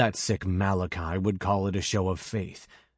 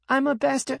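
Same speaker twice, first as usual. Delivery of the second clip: put-on little girl; 3 dB louder than usual.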